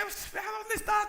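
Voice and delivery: lightly voiced kid, stretched out in wonder; child's voice. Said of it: "Y decía".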